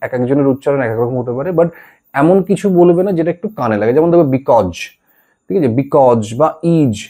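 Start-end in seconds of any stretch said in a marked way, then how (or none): 4.4-4.8 because ending in a "j" sound instead of z; "bikoj" with j ending. Shown as a demonstration. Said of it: "এরকম উচ্চারণ করবে না". 5.8-7.0 because and is ending in "j": "bikoj", "ij". Shown as a demonstration. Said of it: "এরকম উচ্চারণ করবে না".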